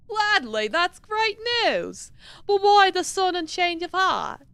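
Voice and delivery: high, gleeful character voice; light up with high-pitched eagerness; delighted game character. Acted. Delighted that the offer is accepted, high excited voice.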